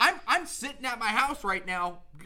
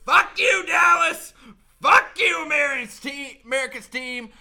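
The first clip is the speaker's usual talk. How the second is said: mock press conference shout; 3 semitones higher, 9 dB louder than usual. Dak yelling at the press conference, hammed-up shout. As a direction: scream it at the press like a furious quarterback. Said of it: "march into a fucking press conference and be like".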